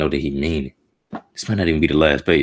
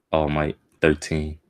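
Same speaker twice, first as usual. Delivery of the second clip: ordinary talk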